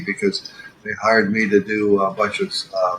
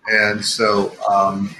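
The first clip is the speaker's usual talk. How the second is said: ordinary talk, nothing marked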